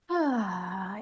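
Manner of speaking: long, sighing "ah" on the out-breath; audible exhale. Shown as a demonstration. Host shows listeners how to breathe out with sound, audible exhale. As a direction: breathe out slowly on an open, releasing ah. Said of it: "perhaps with some sound".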